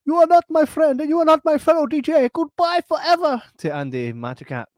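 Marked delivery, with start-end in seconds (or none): 0.0-3.4 high, loud melodramatic farewell; a wounded friend storming off, hammed up. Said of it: "he's like".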